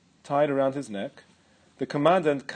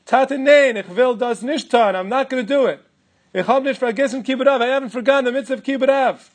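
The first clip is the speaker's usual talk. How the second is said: raised, higher desperate protest; pleading refusal; 8 semitones higher, 6 dB louder than usual. Retold or imitated. Son refusing his father at the gallows, desperate raised protest. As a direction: refuse him, voice climbing with panic.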